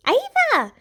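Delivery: put-on high, loud childish squeal. A narrator's reading. Little sister squealing to get attention. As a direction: squeal her name way up high. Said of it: "Branda squealed".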